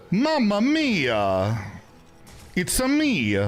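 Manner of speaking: high, slow hammed-up Italian accent, drawn out; playing up the Italian accent after being teased; Italian Mario accent. Teased about sounding Italian, he hams up a high Italian accent. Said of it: "sound like an Italian chef".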